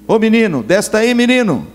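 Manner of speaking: Minas call. Retold or imitated. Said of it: "o mineiro é menino".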